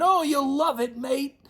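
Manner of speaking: high, bright imitated Aussie reassurance; cheery Aussie reply. Rafter promising a newcomer he'll love Melbourne, high mock Aussie. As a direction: sell it like a friendly Aussie host.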